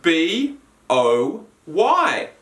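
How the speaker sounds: B O Y spelled out slowly and low. Shown as a demonstration. Teacher spelling boy for pupils, slow and letter by letter. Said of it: "boy becomes boy".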